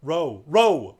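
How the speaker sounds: loud bellow; bellowed order. Acted. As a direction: bellow at the rowers. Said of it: "bellowed Caspian".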